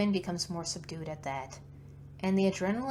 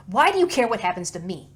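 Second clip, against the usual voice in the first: louder, pressing question; pouncing challenge; 3 semitones higher, 13 dB louder, faster than usual. Acted. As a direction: seize on her words and push hard. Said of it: "Quinn pounces on that".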